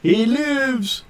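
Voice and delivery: high-pitched stretched call; drawn-out high exclamation; crowd cry. Acted. Repeats the fighters' cry.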